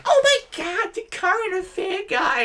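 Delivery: very high, excited squeal; gushing old ladies. Retold or imitated. Old ladies spot the current affair reporter, mock gushing. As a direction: imitate thrilled old ladies in falsetto. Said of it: "all these old ladies are like".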